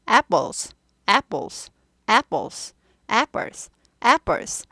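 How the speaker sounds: apples with a hard, tensed p, said slowly over and over; steady repetition drill of apples; repeated model pronunciation. Shown as a demonstration. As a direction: drill apples with a firm tensed p for students to copy. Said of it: "잘 따라 하세요".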